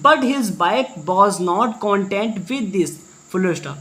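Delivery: drags each word out, very slow; very slow reading aloud. Shown as a demonstration. Teacher reading the line to students, very slowly. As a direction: dictate it word by word.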